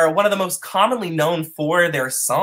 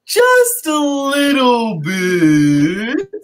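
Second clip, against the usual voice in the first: high, very slow, loud whale call; 9 semitones higher, 4 dB louder, much slower than usual. Pretend. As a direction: moan the words like a singing whale. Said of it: "my whale voice".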